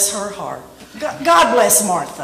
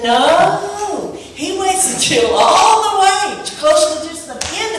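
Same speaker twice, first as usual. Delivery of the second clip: preaching at full force; 7 semitones higher, slower than usual. Acted. Preacher building to a shout about God waiting, full force. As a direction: drive it home to the congregation.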